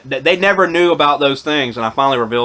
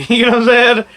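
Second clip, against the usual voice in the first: high, louder, laughing question; real laughing; 9 semitones higher, 5 dB louder than usual. Real, not acted. Vlogger checks that viewers follow, laughing as he asks. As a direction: break into a laugh while asking.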